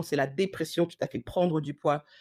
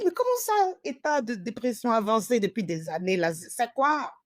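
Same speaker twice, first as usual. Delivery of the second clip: much higher, outraged disbelief; retold stunned protest; shocked disbelief; 4 semitones higher than usual. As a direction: squeak it out in shock. Shocked reply to diagnosis.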